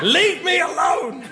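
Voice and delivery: high-pitched, drawn-out comic shout; mock outburst. Panellist cornered over his wrong answer, mock outburst. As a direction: yell it as a mock-desperate protest.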